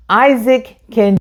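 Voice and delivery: plain reading voice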